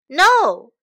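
very loud, high burst; shouted refusal. Acted. Shen refuses the kind woman, shouting. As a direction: refuse at full volume. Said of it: "shouted Shen".

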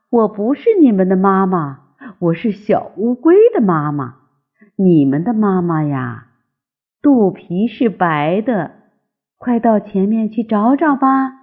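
plain reading voice

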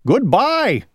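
high called goodbye; calling out. Acted. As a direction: call it after him. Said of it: "called Owl".

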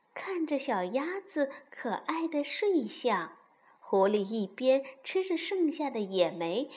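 plain reading voice

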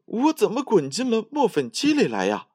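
very high, bewildered character voice; alarmed cry. A narrator's reading. Tom thinking he rolled into a mill, alarmed cry. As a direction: exclaim in high-pitched dismay. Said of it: "小汤姆叫了起来".

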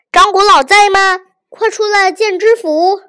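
loud, high bellow at the door; shouting. A narrator's reading. Magistrate barging in and shouting for the old man. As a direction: yell for him to come out. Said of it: "一进门就嚷".

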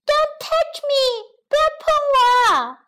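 high, protesting creature voice; alarmed gingerbread man. A narrator's reading. Gingerbread man fears the woman, high protest. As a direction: warn them off in a high voice. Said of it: "却以为大婶要伤害自己".

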